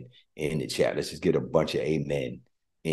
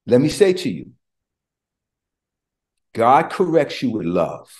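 raised, emphatic preaching; 7 semitones higher, 7 dB louder than usual. Real, not acted. Preacher telling the congregation God corrects with love, emphatic. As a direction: drive the point home from the pulpit.

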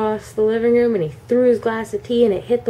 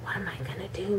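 unvoiced, soft panicked whisper; 18 dB quieter than usual. Girl left outside fearing dad, whispering. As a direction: whisper in hiding, scared. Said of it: "Kimberly was like".

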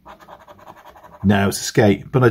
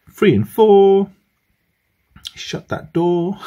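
bingo caller; 7 semitones higher, slower than usual. Pretend. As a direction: call the rhyme like a bingo night. Bingo call and rhyme.

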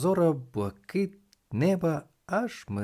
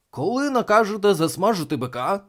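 loud, high questioning voice; eager reporter; 9 semitones higher, 7 dB louder than usual. A narrator's reading. Reporter asking the young winner, loud eager question.

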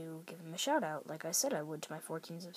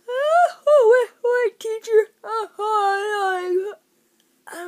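high, loud hammed-up yawns over the words; yawning student; 19 dB louder, much slower than usual. Student yawning at the teacher in class, hammed-up loud yawns. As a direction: overdo a yawn so the teacher waits. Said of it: "instead of going like this".

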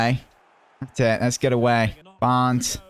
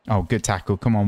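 ordinary delivery

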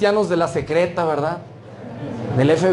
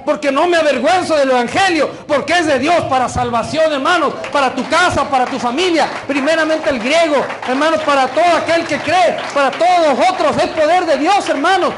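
raised, driving, high-pitched sermon delivery; full-force preacher proclaiming scripture with conviction; fervent preaching; 6 semitones higher, 4 dB louder than usual. Real, not acted. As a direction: hammer each phrase out to the congregation.

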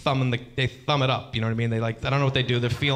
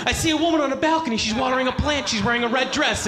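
high, fast, breathless scene painting; hammed-up movie narration; dreamy describing; 10 semitones higher than usual. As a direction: rush the romantic picture out. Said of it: "And I'll be like".

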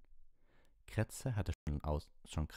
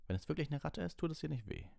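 higher, gentle teacher voice; Lupin; 9 semitones higher than usual. Acted. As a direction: ask it like a patient teacher.

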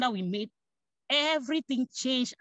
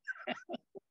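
high laugh; 10 semitones higher, 11 dB quieter than usual. Real, not acted. Laughing at the family's teasing.

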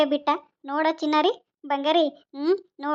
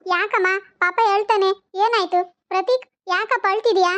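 put-on cooing to baby; 4 semitones higher than usual. Mother soothing her crying baby.

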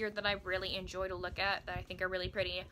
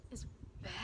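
whispered, much softer confession; guilty whisper; 3 semitones lower, 13 dB quieter than usual. Real, not acted. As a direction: let it slip out in a whisper. Admitting a guilty fact.

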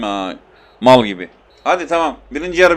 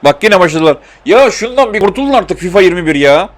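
louder, higher fed-up grumble; exasperated complaint; 4 semitones higher, 6 dB louder than usual. Real, not acted. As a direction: moan at the game like it's wasting your time. Complains about game's stalling.

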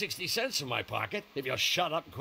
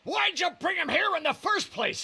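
high, loud furious yell; angry shout; 10 semitones higher, 4 dB louder than usual. Acted. Man yelling at Lee for bringing the stranger, furious shout. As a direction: blast him with the blame.

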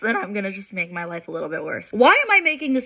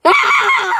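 shrieking laugh; 8 dB louder than usual. Real, not acted. Laughing at life's irony.

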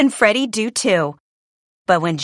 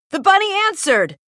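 usual speaking voice

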